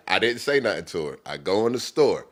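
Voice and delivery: usual speaking voice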